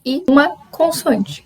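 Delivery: normal talking voice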